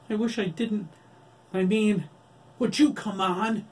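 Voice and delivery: high, loud tearful plea; anguished cry. A narrator's reading. Adam crying out after the killing. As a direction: plead through tears. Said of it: "Adam cried".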